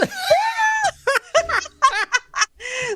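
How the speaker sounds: a big high laugh that breaks into a shout. Real, not acted. Telling a friend about her journals blowing away, shrieking with laughter.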